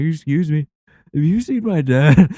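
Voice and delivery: high, whiny plea to a stranger; imitating a desperate kid, loud and high; mock lost-kid panic. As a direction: wail it like a lost child, hammed up. Retold lost kid asks strangers.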